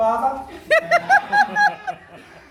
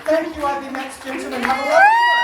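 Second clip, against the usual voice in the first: raised voice rising to a shout; calling out sign-off; 6 semitones higher, 8 dB louder, faster than usual. Real, not acted. Comic leaving the stage, shouting good night. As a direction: build to a big high shout. Signing off to the crowd.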